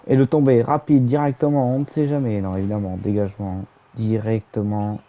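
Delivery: live play-by-play call; wrestling commentator. Acted. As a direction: narrate the match as it happens.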